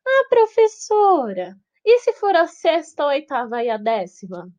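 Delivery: high, whiny student asking the teacher. Acted. Teacher voicing a confused student's objection, high and whiny.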